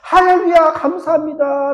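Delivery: high, loud hallelujah of thanks; joyful praise. Acted. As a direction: burst out in glad praise. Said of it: "라면서 기도 응답을 받았음을 찬양해야지".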